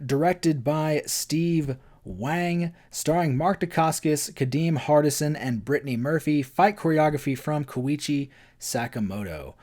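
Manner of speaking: normal talking voice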